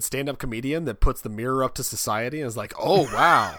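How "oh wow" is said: loud, awed exclamation; mock amazement. Audience of a stand-up comedian, loud mock amazement. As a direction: burst out as if suddenly enlightened.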